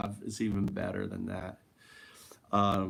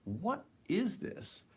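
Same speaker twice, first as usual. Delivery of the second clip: awed question; 8 semitones higher, 6 dB quieter, slower than usual. Retold or imitated. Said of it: "and I'm like".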